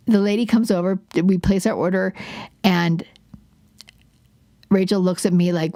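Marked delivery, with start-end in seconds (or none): none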